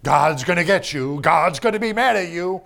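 loud mocking imitation of a scare preacher; mock fire-and-brimstone. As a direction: ham up a threatening sermon, loud. Mocking threatening-God preaching.

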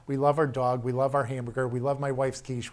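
normal talking voice

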